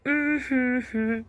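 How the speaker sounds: nervous mock hum. Nervous reaction to the brawl.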